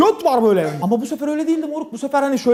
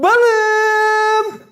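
camp call; 9 semitones higher than usual. Retold or imitated.

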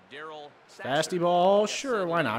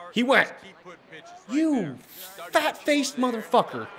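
worked-up yelling; 10 semitones higher than usual. Real, not acted. Player disputing the umpire's call, worked-up yelling. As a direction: yell it high in heated protest. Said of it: "I'm getting excited".

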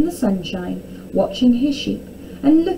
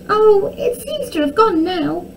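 high, sugary princess voice; sweetly innocent; 11 semitones higher, 4 dB louder than usual. A narrator's reading. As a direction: feign innocence ever so sweetly. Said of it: "Arabella said sweetly".

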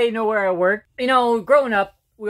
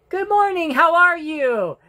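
much higher, slowed sing-song teacher greeting; teacher's greeting; 9 semitones higher than usual. Retold or imitated. Teacher greets the student each morning, sing-song and high. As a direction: put on a chirpy teacher voice.

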